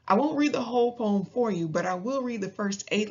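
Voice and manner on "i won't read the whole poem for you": ordinary delivery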